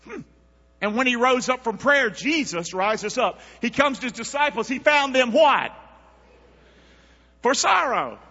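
usual speaking voice

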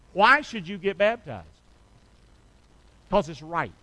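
ordinary delivery

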